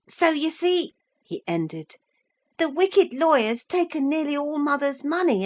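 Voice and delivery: put-on a small boy gushing over his cat; a hint of an excited young boy; little boy Tavy.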